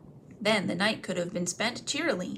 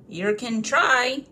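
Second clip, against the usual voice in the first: slow, loud troll threat; menacing troll; 4 semitones higher, 7 dB louder, much slower than usual. Acted. Bert reaching for a skewer, slow loud threat. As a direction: drag it out menacingly.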